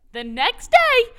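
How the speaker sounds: sudden high, slow build-up; excited build-up. Real, not acted. Telling a teammate how the trade unfolded, high and slow. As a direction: raise the pitch, drag it out for suspense. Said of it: "Then the next day".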